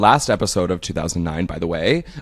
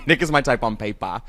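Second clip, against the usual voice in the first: laughing imitation of a bad British Love Island accent; friend's Love Island accent, mocked with laughter; 6 semitones higher than usual. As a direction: tease a friend's British accent through giggles. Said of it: "you tried to do the Love Island accent".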